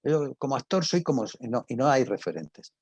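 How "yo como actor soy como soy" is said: mock smug actor. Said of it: "en el mundo de la radio".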